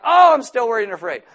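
high, loud mock fretting; mock worried whine. Preacher imitating an anxious believer still afraid. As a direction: whine like someone still scared. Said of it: "Be like".

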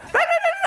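very high-pitched babble; squeaky mock child chatter; imitated kid gibberish. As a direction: babble like an unintelligible little kid. Imitating kids he cannot understand.

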